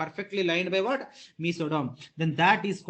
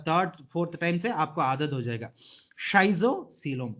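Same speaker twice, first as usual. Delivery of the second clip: ordinary talk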